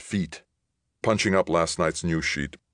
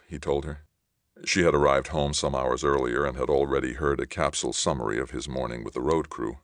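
ordinary delivery

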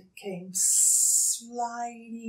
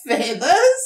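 pleased rising question; smiling; 8 semitones higher, 11 dB louder than usual. Acted. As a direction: grin at the idea. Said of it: "smiled Dingo".

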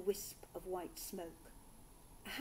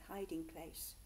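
usual speaking voice

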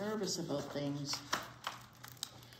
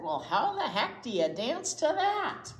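put-on louder doubtful kid's question; skeptical kid; 8 semitones higher, 6 dB louder than usual. Teacher voicing Tiffany scoffing at the music, louder doubtful kid. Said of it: "Someone said".

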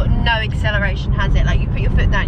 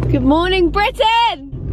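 TV presenter greeting; 9 semitones higher, much slower than usual. Pretend.

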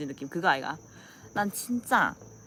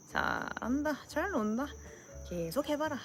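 higher, softer dry jeer; sardonic egging-on; 6 semitones higher, 7 dB quieter, slower than usual. Acted. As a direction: needle them quietly from the sidelines. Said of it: "이렇게 하는 여자애들이 있어".

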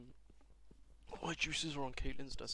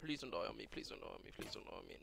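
fading plea; 3 semitones lower, 7 dB quieter, faster than usual. Real, not acted. Pleading with game character.

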